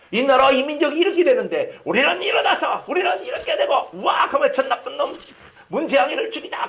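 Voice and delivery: hammed-up rally shout with laughter breaking through; mock angry rant. Preacher mocking a self-righteous mob, laughing mock shout.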